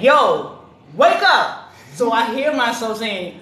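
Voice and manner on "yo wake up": loud, high wake-up call. Retold or imitated. Mom waking her son through his Alexa, calling loudly. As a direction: wake him through the speaker. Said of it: "and I be like".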